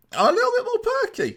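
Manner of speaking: very high falsetto perky squeak. Acted. Acts out his lifted morale.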